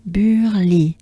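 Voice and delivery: Burly joined, with French u and throat r; French pronunciation. Shown as a demonstration. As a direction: model "Burly" as one French word. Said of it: "So we have".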